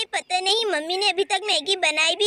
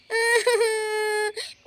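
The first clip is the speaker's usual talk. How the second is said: mother sobbing. Acted. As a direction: cry your heart out, sobbing. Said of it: "और यहाँ पर अंजलि रोने लगती है।".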